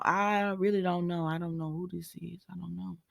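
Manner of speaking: from soft to almost silent; fading to nothing. Real, not acted. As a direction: let it die away, baffled. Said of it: "trailing off, fading".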